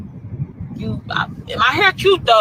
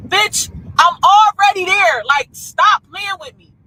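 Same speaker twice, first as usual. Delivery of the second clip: exasperated retort; 6 semitones higher, 3 dB louder than usual. Retold or imitated. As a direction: retell it fed up, pitched up and stretched. Retelling her row over change.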